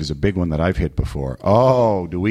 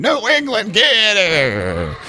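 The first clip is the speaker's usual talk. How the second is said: mock hostile yell; 13 semitones higher, 5 dB louder, much slower than usual. Southerners reacting to New England.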